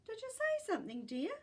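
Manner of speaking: high, quick motherly call; mum calling. A narrator's reading. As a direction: call brightly down the hall as Mum. Mother calls to son.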